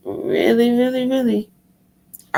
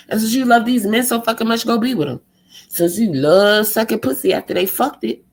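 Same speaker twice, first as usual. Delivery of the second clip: ordinary delivery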